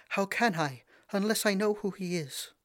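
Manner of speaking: high, pleading princess voice; distressed princess. A narrator's reading. Princess begging her father, high and upset. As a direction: press him for an answer. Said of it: "cried the poor princess".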